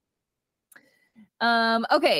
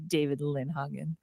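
mock hushed name; 6 semitones lower, 8 dB quieter than usual. Mocking the name, podcast hosts giggle.

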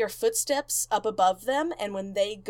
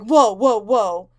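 much louder alarmed whoas; startled whoa; 11 dB louder, slower than usual. Retold or imitated. Zach reacting to his wrist being grabbed, alarmed. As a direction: jump back in alarm.

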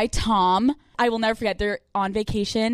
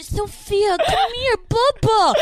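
put-on high baby voice; 10 semitones higher, slower than usual. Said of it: "can you imagine".

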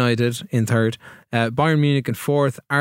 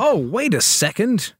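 higher voice rising on "second"; surprised realisation; 7 semitones higher, slower than usual. Real, not acted. Host suddenly noticing the league table, surprised. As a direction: stop short in surprise, pitched up.

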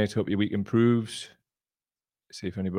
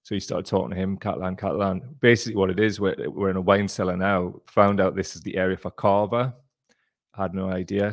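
usual speaking voice

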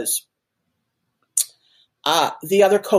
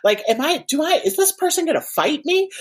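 high-pitched rush of worried questions; mock panic, pitched way up, thoughts tumbling out; panicked inner monologue; 9 semitones higher than usual. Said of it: "in my head, I'm like".

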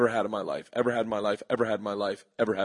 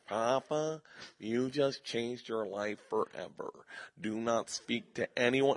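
Barry Katz impression; 8 dB quieter, slower than usual. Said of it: "how did Barry Katz say it".

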